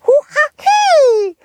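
loud high squeaky worm shriek; a tiny creature shrieking in pain; worm cry. Acted. Worm pushed hard.

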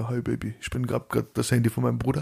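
soft, mock gloomy hi Baby; depressed. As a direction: mope down the phone, quietly. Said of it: "oh, Depri". (gespielt deprimiert und leise, zur Freundin am Telefon)

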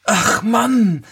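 high-pitched wife's voice; dismayed wife. A narrator's reading. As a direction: sigh to the husband in a high character voice. (hohe, klagende Frauenstimme)

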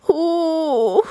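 high whimpering little whine; scared cheetah whimpering from the bushes; whimpering huh. A narrator's reading. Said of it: "jammert es".

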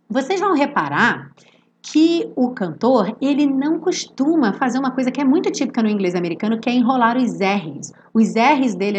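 normal talking voice

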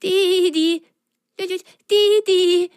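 high voice calling the dog. Acted. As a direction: call the dachshund over. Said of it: "rief Pitsch den Dackel herbei". (rufend, hohe Stimme)

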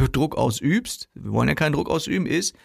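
usual speaking voice

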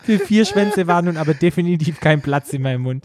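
high, strained reading through tears of laughter; crying with laughter. Real, not acted. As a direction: read on while fighting a laughing fit. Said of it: "ich muss grad echt heulen".

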